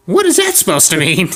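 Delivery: high-pitched granny voice, taking offence; high mock-offended old lady. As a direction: put on an indignant old woman. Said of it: "and she's like".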